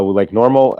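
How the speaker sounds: ordinary delivery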